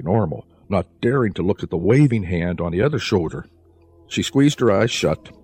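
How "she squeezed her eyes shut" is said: ordinary delivery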